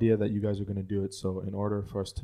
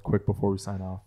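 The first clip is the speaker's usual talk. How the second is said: ordinary talk, nothing marked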